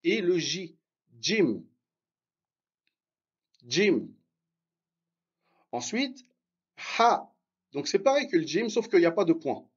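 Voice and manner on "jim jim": Jim said as dj-im, a d before the j; dj sound. Shown as a demonstration. Teacher repeats Jim for students, dj sound. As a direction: model the dj in Jim. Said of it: "j'ai mis le D et le J".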